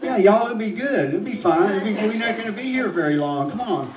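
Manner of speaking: high, easygoing South Alabama twang; carefree Southern belle reassurance. Retold or imitated. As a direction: brush off the worry in a sunny Southern voice. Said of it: "I'm from South Alabama, and I'm still like".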